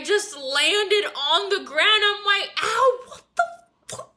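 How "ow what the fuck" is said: high mock yelp of pain, half laughing; retold pained yelp. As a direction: squeal it like the slap hurt. Retold yelp at Hunter slapping.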